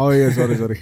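sorry through a laugh; laughing apology. Real, not acted. Laughs at mishearing. (minta maaf sambil tertawa)

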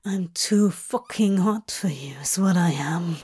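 breathy whispered boast; breathed. Acted. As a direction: breathe the words out. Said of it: "Crowley breathed".

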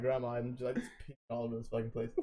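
low, soft, hurried mock confession; retold sheepish confession. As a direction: retell owning up to grandma, voice dropped. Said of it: "I'm probably crying again".

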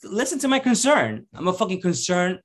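high, pleading, half-joking voice; mock pleading. Guy pleading with the bouncer not to kick him out, high mock plea. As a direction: beg the bouncer, hammed up.

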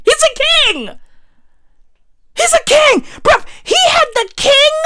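very high-pitched, crowing exclamation; hyped-up proclaiming. Real, not acted. Boasting that our president is a king, exultant. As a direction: proclaim it with soaring, excited pitch. Crows about president's status.

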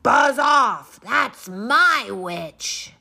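loud, slow monstrous voice; beastly growl. Acted. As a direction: shout the dragon away menacingly. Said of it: "it said to the dragon".

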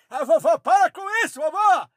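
high, fast, loud telling-off; exasperated shout. Acted. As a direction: snap at her to stop, loud and quick. Scolding grandma to stop.

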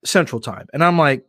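usual speaking voice

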